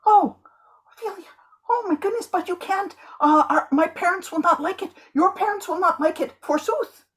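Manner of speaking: high, squealing melodramatic lover; hammed-up swooning Romeo in a high voice; showing off. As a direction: overact the panic, way up high. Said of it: "you're showing off".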